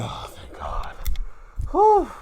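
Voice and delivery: breathy whispered relief; relieved whisper. Retold or imitated. Fan relieved after the title is retained, whispered thanks. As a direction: whisper it like a weight just lifted. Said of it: "I said".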